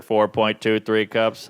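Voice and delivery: Alexa voice. Acted. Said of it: "Hey, Alexa".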